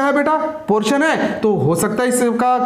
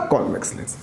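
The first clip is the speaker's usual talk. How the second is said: whispered answer; 12 semitones lower, 7 dB quieter, faster than usual. Shown as a demonstration. Teacher whispering the answer to his students. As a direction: breathe the answer softly, almost unvoiced.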